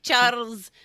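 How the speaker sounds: Moira's Scottish accent. Shown as a demonstration. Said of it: "she's like".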